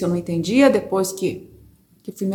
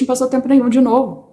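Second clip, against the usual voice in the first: ordinary delivery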